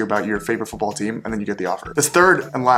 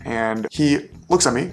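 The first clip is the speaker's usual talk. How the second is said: normal talking voice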